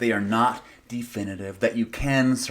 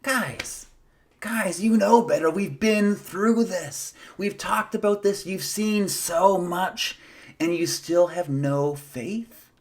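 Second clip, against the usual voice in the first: high, slow, mock-weary scolding; weary Jesus scolding; 8 semitones higher than usual. Jesus scolding the faithless disciples, high and slow in mock weariness. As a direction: play a tired teacher gently scolding friends. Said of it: "It's like he's saying".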